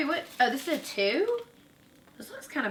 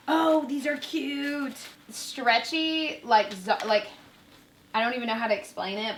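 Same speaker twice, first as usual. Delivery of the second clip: ordinary talk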